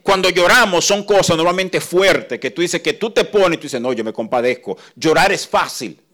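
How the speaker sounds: loud, ringing pulpit voice; loud impassioned preaching; preaching at full force. Real, not acted. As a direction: proclaim it to the congregation.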